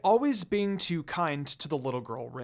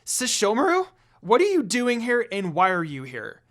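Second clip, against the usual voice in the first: louder startled demand; loud question; 5 semitones higher, 7 dB louder than usual. Acted. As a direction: call him out loudly in shock. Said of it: "questioned him loudly".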